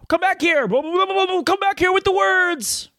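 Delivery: high, slow, hammed-up call after runaway lips; mock chase yell. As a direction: shout after your fleeing lips like a cartoon. Yells at own runaway lips.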